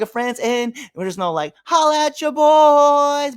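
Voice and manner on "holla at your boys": drawn-out hype shout of the catchphrase. Shown as a demonstration. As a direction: stretch it out like a hype man. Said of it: "but we could also".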